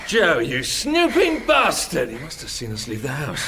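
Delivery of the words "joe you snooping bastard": furious yell, much higher and louder, drawn out slow; angry shout. Acted. At the servant spying on them, furious shout. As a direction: roar it at the snooper.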